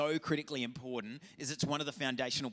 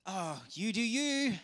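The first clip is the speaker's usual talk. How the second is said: breezy shrug; 7 semitones higher, 3 dB louder, slower than usual. Retold or imitated. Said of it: "and go".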